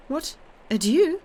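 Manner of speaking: high, loud burst of disbelief; startled exclamation. A narrator's reading. Julie hearing the Count leave at once, startled high cry. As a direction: cry out in shock at the sudden farewell. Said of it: "Julie exclaimed".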